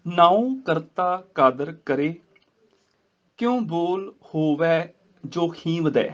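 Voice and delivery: slow recitation resting on ਕਰੇ; pause after ਕਰੇ. Shown as a demonstration. Teacher modelling the full verse, slow recitation with a rest after ਕਰੇ. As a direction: stretch the words and stop after ਕਰੇ. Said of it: "ਕਰੇ ਦੇ ਉੱਪਰ ਇਹਦਾ ਵਿਸਰਾਮ".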